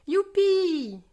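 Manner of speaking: high, loud yippee; joyful whoop. A narrator's reading. Passengers above the clouds, joyful whoop. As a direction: whoop with joy, falling away. Cheer as plane climbs.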